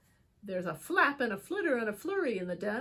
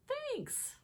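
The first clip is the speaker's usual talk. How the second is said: high thanks; 6 dB quieter than usual. Acted. Bear voiced in story.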